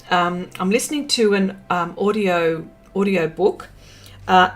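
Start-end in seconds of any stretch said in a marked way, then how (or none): none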